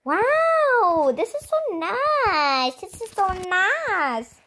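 high, slow, stretched-out admiration; silly singsong wow; weird drawn-out gushing. Real, not acted. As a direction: swoop up and stretch every phrase. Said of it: "I don't know why I'm talking so weirdly today".

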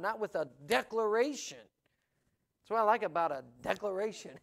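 preaching. Acted. Preacher pressing Paul's message on the congregation, fervent. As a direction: preach it to the room with rising fire. Preacher back to full force.

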